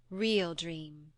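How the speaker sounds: plain reading voice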